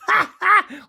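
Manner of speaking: a sudden, high, loud burst of laughter; real high cackle of a laugh, loud. Real, not acted. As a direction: burst out laughing at your own point. Laughs at his own joke.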